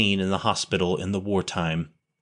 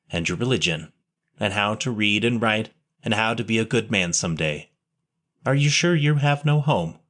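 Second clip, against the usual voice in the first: ordinary delivery, nothing marked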